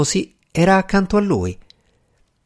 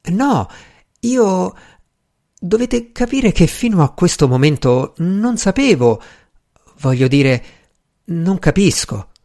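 halting, higher-pitched flustered explanation; 5 semitones higher than usual. A narrator's reading. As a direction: stumble and restart, at a loss. Flustered husband struggles to explain. (confuso, esitante)

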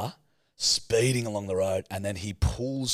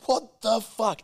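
high-pitched retold disbelief; shocked exclamation; 12 semitones higher, slower than usual. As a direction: blurt it in acted shock. Retold shock at near miss.

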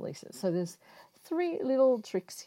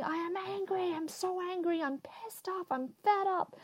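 much higher, strained demo of venting rage; mock angry yelling; 13 semitones higher than usual. Shown as a demonstration. Showing viewers how to vent at a pillow, mock angry yelling. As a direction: act out the pillow-punching outburst. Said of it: "yelling and screaming, saying".

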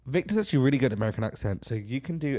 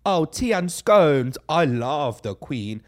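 slow, drawn-out mock British, loud start; bad American-doing-British accent; 5 dB louder, slower than usual. Mocking American impressions of the English, exaggerated British accent. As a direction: ham up a fake British accent, stretching it out. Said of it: "no one speaks like that".

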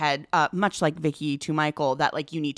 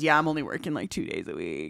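put-on lazy teen voice; 6 semitones lower, 5 dB quieter than usual. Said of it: "talking to his friends".

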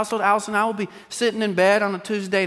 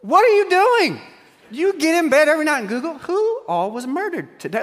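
exasperated disbelief; 10 semitones higher than usual. Retold or imitated. Husband scolding wife for doomscrolling, high-pitched and exasperated. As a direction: throw up your hands at her. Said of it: "I'm like".

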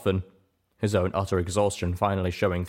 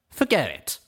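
higher-pitched dismissive huff; huffy; 6 semitones higher than usual. A narrator's reading. Calamity gives up on wearing the armor, huffy refusal. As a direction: huff it out with a stomp. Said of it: "he huffed with a stomp".

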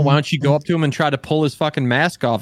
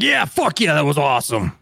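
excited; 3 semitones higher than usual. Retold or imitated. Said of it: "He's like".

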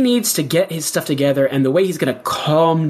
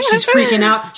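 excited. Real, not acted. Excited retelling.

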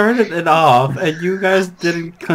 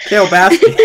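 put-on southern accent; 4 semitones higher, 8 dB louder than usual. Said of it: "most southern, like, Joe Exotic accent".

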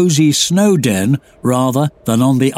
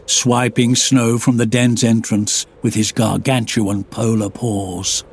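usual speaking voice

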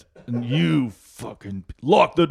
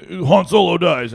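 loud, high mock spoiler; spoiler yell; 8 semitones higher, 7 dB louder than usual. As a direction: blurt the spoiler.

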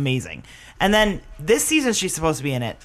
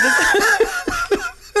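laughing; 13 semitones higher than usual. Real, not acted. Laughing while talking.

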